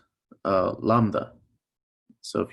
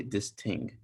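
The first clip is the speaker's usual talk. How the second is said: put-on Jamaican accent; 6 dB quieter than usual. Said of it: "someone with a Jamaican accent".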